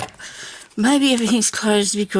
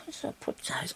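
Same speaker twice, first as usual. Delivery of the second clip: whisper; 9 semitones higher, 14 dB quieter than usual. Real, not acted. Hunting for lost words.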